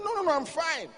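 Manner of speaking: high-pitched mock-angry wife; hammed-up testy denial. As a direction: insist in a rising huff, imitating her. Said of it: "She was angry".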